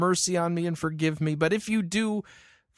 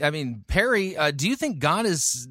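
normal talking voice

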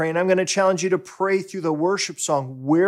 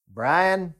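Southern pastor. Retold or imitated. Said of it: "I can't do his Southern".

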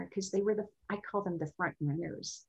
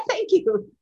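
laughing thanks; 12 semitones higher, 10 dB louder than usual. Real, not acted. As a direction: thank you through real laughter, louder. Thanks while still laughing.

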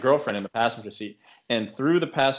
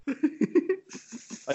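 laughing; 4 dB quieter than usual. Real, not acted. Laughing while recounting wave.

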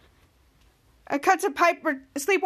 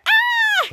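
high, loud mole-person shriek; very high "Ah!" cry; mock scream; 5 dB louder than usual. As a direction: imitate a panicked creature scream. Mole people hit by light.

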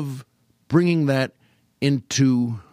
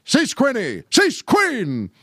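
high, swooping repeated command; W. C. Fields impression; 10 semitones higher, 3 dB louder, slower than usual. Circus man orders his elephant to stop, high cries. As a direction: cry out the order, falling away each time. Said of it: "in that W C Fields way".

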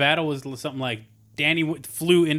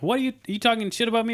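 high, fast mock outrage; imitating an offended friend, pitched up and rushed; mock indignant; 8 semitones higher, faster than usual. Tucker confronting Gregor: high, fast mock indignation. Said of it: "Tucker comes up and he's like".

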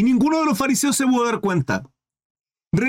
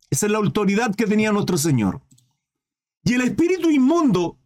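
ordinary talk, nothing marked

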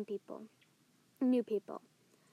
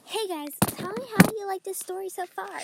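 louder, peppy host intro; upbeat host voice; 6 semitones higher, 7 dB louder than usual. Shown as a demonstration. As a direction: greet the viewers brightly. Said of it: "I should just be myself".

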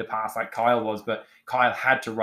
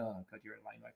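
muttered self-mockery; 2 semitones lower, 20 dB quieter, faster than usual. Self-mocking inner voice.

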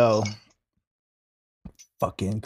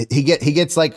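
usual speaking voice

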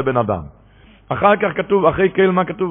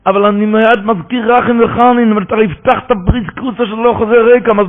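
fervent preaching; 6 semitones higher, 3 dB louder than usual. Acted. Preaching with rising fervor.

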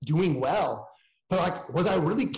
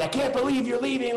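mock yell, pitched much higher; imagined yell; 8 semitones higher than usual. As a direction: voice the scolding landlord with a sudden high jump. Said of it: "like, yell at me".

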